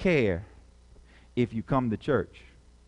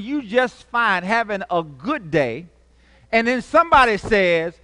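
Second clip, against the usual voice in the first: high, loud preaching at full force; fiery preaching; 8 semitones higher, 6 dB louder than usual. Acted. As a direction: drive the sermon home to the congregation.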